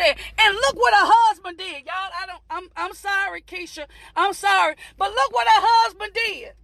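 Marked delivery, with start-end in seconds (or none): none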